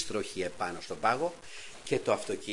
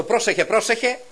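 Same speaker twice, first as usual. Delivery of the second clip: nagging warning; 8 semitones higher, 8 dB louder than usual. Retold or imitated. Wife nagging driver on icy road.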